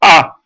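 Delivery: "A" with the mouth wide open, loud; loud open vowel "A", air forward. Shown as a demonstration. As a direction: project a big open "A". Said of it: "bouche ouverte".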